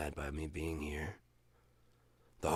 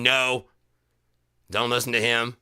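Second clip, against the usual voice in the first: louder, urgent no; mock warning; 6 semitones higher, 9 dB louder than usual. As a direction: warn him urgently. Imagined warning voice.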